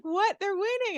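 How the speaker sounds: high, loud shocked outburst; high, loud mock shock at the game; surprised disbelief. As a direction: cry out in disbelief at the score. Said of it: "And I'm like".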